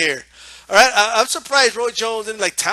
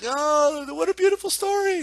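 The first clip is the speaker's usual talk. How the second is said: high-pitched mock swooning; put-on high, sentimental gush; gushing women viewers; 7 semitones higher, 4 dB quieter than usual. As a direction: imitate women cooing over a touching story. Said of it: "trying to get women to get into it".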